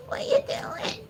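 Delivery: "What you doing?" squeaked very high; squeaky pet voice. Real, not acted. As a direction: squeak it up at the dog like a toy. Cooing at the dog.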